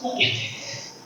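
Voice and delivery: whispering a prayer to God after an insult. Retold or imitated. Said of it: "I pray and I say".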